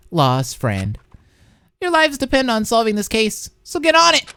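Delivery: high, drawn-up announcement voice; high-voiced announcer character. Fanfic reader voicing the body discovery announcement, high announcer voice.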